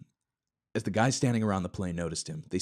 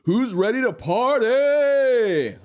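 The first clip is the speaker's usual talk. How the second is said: much higher, louder, slow party shout; booming call; 10 dB louder, much slower than usual. Acted. Epstein calling to the men, booming and slow. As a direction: shout it across the runway. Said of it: "A booming voice echoed across the runway".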